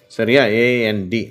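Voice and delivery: normal talking voice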